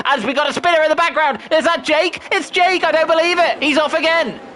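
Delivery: rising excited race call; motorsport commentator. Acted.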